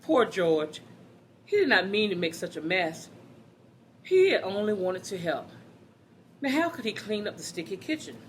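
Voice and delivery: lively, animated storybook reading; reading to children. Acted. Teacher reading a picture book to the class, animated. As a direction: read it out to a class of kids with bounce.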